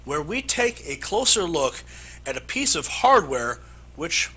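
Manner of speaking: emphatic anchor intro, key words punched high; animated, punchy newscast delivery; news read. Acted. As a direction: sell the exclusive report like a TV anchor. Anchor introduces exclusive report.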